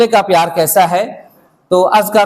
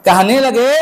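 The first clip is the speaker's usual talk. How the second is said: usual speaking voice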